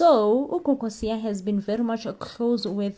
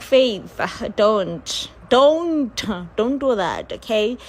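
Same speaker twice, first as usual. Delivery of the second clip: mock scolding; 6 dB louder, slower than usual. Scolding a TV character.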